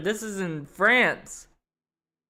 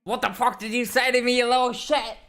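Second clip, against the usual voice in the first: fast, raised mock comeback snapped at the screen; mock angry retort; 6 semitones higher, 4 dB louder, faster than usual. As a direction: fire it back at the villain, hammed-up. Mock retort at game villain.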